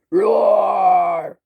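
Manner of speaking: fierce little storybook roar; loud, drawn-out play roar; dinosaur roar. Acted.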